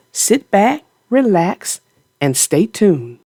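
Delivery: usual speaking voice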